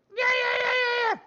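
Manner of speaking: very high, loud yapping yeahs; a dog yelping with glee; excited yelping. Acted. Dog character yelps excitedly.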